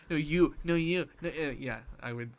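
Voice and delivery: ordinary talk, nothing marked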